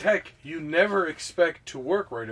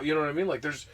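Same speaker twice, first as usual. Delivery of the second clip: ordinary talk, nothing marked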